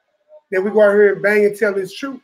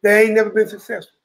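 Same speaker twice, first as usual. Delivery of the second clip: ordinary delivery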